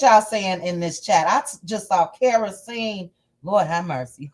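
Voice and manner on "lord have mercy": usual speaking voice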